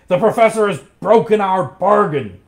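loud, high-pitched outburst; angry cry. A narrator's reading. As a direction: cry it out in outrage. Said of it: "he cried".

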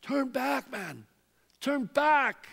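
calling out to someone who turned their back. Retold or imitated. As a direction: shout the plea across a distance. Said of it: "imagine God calling out to them".

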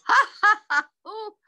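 high acted laugh subsiding into a sigh; laugh, then wind down with a long oh; loud storybook laugh trailing into a tired groan; laughing bear. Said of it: "growled Bear".

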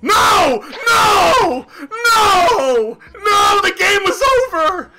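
real gamer rage; enraged shouting. Real, not acted. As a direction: scream in outrage, then rant through the insults. Outraged at losing the card game.

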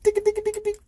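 playful high tick tick tick of dialing a phone; high squeaky dialing ticks. Retold or imitated. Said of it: "He's like".

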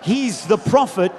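loud, rousing preacher's delivery; full-force preaching. Acted. Preacher rallies the congregation on the triumphal entry, full-force preaching. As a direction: preach to a big crowd with stretched-out emphasis.

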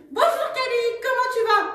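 high, bright welcome; cheerful greeting. Acted. Jules welcomes Cali with a big smile, high. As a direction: greet her warmly.

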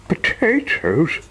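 high, slow dismayed question; shocked disbelief. Acted. Mister Mean seeing money turn to potatoes, high and slow. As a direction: stare at the potatoes aghast.

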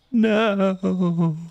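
squeaky high dismayed no. Real, not acted. Streamer buying too much sugar, high dismayed no. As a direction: yelp it out high.

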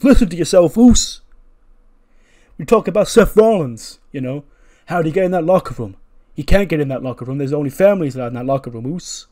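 loud, fast, heated retort; Jey Uso. Acted. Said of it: "And Jey goes".

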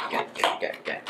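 under-the-breath chant; soft repeated whisper; whispered muttering. Real, not acted. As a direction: whisper the words to yourself while unwrapping. Muttering while unwrapping.

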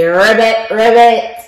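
ribbit ribbit croaked like a frog; playful frog noise. Acted. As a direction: give the frog its croak for the kids.

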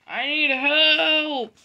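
high, loud, stretched-out holler; yell for help. Acted. Derailed Oliver calling for rescue, yelling slowly. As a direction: draw out the plea at a yell. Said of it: "yelled Oliver".

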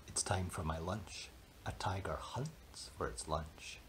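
low stealthy whisper; creeping whisper on the hunt. Acted. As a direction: hush it like stalking prey. Mouse hunting stealthily.

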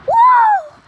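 high, very loud whoops; thrilled screams. Real, not acted. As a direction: scream with joy and fright. Racing downhill.

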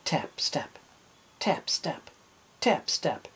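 soft, spaced-out footstep words; testing steps. A narrator's reading. Captain testing his peg leg, careful spaced steps. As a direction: place each pair carefully, with pauses between. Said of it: "He tested his peg leg".

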